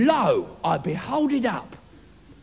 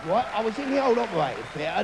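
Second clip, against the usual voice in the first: normal talking voice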